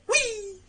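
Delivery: high, drawn-out mock squeal; imitated "whee". Commentator mimics Booker sailing through the air, high mock whee. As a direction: squeal playfully like someone flying. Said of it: "He's flying".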